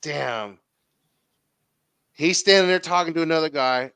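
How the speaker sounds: ordinary talk, nothing marked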